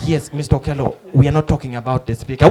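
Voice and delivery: low, measured correction; patient reporter. Retold or imitated. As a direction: steer him back politely in a low voice.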